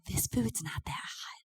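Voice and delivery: whispered, slow, mom complaining about lukewarm food; hushed complaint. Retold or imitated. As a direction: say it under your breath like a picky mom.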